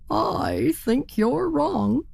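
stubborn turkey. Acted. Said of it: "said the turkey".

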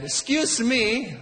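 high, slow, mock-polite Italian accent; an Italian dad, slow and over-polite; an Italian father confronting the line-cutter, slow and polite; Italian dad politely. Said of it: "my dad".

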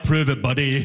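mock the immigration office's demand, deep and curt; government official. Comic mocking the Canadian government's demand, in a low mock official voice. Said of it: "The Canadian government knows that and was like".

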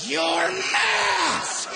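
high, sharp shouted scold; shouted "Your mask!". Retold or imitated. Shopper shrieking at the maskless comedian. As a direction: scream it like a startled rule-stickler.

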